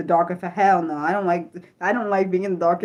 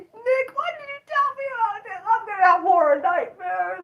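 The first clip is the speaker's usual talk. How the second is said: frightened whine; 11 semitones higher than usual. Real, not acted. Scared by horror image, whining.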